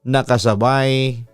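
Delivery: usual speaking voice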